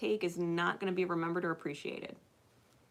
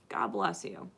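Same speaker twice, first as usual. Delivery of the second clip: much lower, solemn God bless you; mock-solemn low blessing; 13 semitones lower, 4 dB quieter, slower than usual. Speaker blessing arguers, mock-solemn and low.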